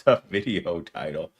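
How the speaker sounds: ordinary talk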